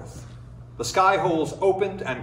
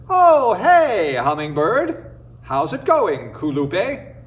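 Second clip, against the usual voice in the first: friendly coyote greeting; 4 semitones higher, 5 dB louder, slower than usual. Acted. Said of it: "Coyote Man".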